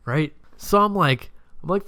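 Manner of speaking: usual speaking voice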